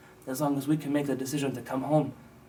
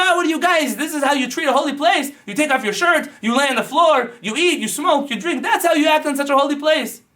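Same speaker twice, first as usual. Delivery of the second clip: high, loud outraged yelling; screaming; 12 semitones higher, 8 dB louder than usual. Retold or imitated. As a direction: scream it at the soldiers in disgust. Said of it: "he started screaming".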